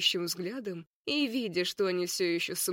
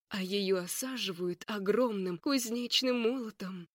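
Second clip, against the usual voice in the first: ordinary delivery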